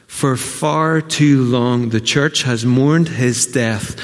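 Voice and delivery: very slow, weighty delivery; grave, drawn-out preaching; slow solemn lament. Acted. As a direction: let each word sink in.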